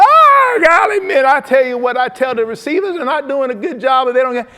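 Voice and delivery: high, loud, fast-talking coach voice. Retold or imitated. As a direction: blast it out like an excited Southern coach. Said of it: "And he kept going".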